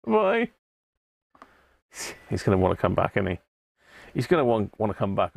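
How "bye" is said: high bye. Real, not acted. Farewell to penguin puppet.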